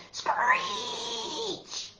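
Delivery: very high falling screech; owl screech. Pretend. Storyteller voicing a screech owl for a child, very high screech. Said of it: "there's a screech owl going".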